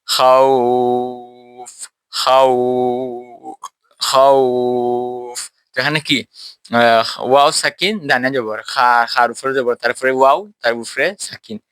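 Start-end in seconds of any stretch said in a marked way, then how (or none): 0.0-5.5 খাউফ drawn out very long each time; খাউফ chanted with a long madd; madd stretched. Shown as a demonstration. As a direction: stretch the খাউ glide slowly, three times over. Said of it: "কি বলি?".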